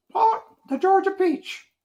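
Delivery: very high, loud bird squawk. Acted. Bird squawking at the name Babe Ruth. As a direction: screech it like an amazed parrot.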